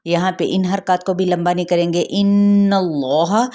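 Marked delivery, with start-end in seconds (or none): 2.0-3.4 slow tajweed recitation of इन्नल्लाह with nasal ghunna on the doubled noon; इन्नल्लाह recited slowly with a held nasal ghunna; Quranic recitation. Shown as a demonstration. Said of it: "नून पे गुन्ना करते हुए".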